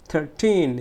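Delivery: "Thirteen" slow, with a long টিন; stress on টিন. Shown as a demonstration. Teacher demonstrating the stretched syllable, long টিন. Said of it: "থার্টিনটা টিন, stretch syllable".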